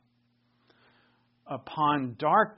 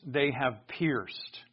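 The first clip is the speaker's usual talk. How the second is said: "they have pierced" said slowly, every word weighted; slow emphasis; much slower than usual. Shown as a demonstration. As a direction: lean on "they have pierced" to make it sink in. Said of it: "Notice how is".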